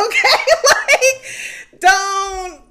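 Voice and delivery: squealing high laugh. Real, not acted. Cracking up loudly at her own lituation joke. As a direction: lose it to a loud laugh. Laughing fit mid-line.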